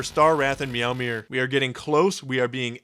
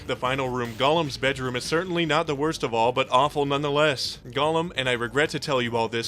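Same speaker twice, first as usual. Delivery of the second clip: ordinary delivery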